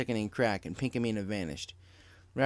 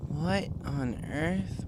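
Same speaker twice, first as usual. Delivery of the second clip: 1 quizzical; 5 semitones higher, much slower than usual. Acted. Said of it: "asked quizzically".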